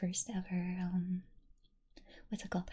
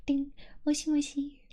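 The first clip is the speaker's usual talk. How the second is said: high, bouncy moshi moshi; sing-song greeting; 7 semitones higher, 4 dB louder than usual. Pretend. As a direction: chirp it like a phone greeting.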